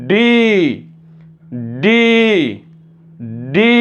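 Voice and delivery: ডি with a long rising i; model pronunciation. Shown as a demonstration. Teacher models the next letter for learners, long emphatic ডি. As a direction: say ডি separately, emphatic and drawn out. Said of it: "এরপরে আমরা যেটা যাবো এইখানে".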